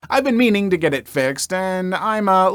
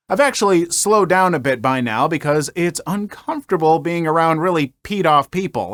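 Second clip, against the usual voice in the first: usual speaking voice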